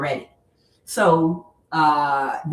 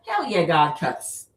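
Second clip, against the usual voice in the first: usual speaking voice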